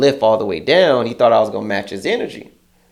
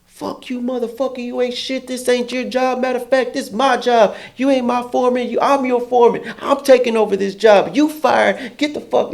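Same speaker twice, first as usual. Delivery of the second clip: mock ranting coworker; 10 semitones higher than usual. Coworker's rant, retold.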